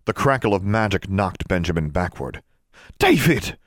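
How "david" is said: high, shocked cry of a name; gasped. A narrator's reading. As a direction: exclaim it in sudden shock. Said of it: "Constance gasped".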